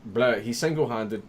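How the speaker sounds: stumbling, restarting mid-word; tripping over words. Real, not acted. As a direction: get tongue-tied and keep restarting. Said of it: "that was difficult to say".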